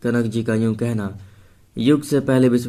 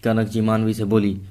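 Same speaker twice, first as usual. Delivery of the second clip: ordinary delivery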